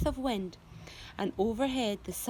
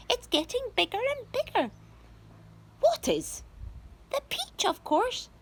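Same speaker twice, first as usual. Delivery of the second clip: high excited aunt. A narrator's reading. Spiker excited.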